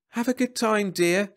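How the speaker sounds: high, sing-out farewell; calling after. A narrator's reading. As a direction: call after a departing wife. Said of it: "Jeb called after Laurie".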